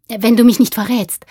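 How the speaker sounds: put-on high, loud, urgent boy; hurried. A narrator's reading. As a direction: blurt it out before he leaves. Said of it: "sagte Jürgen da schnell".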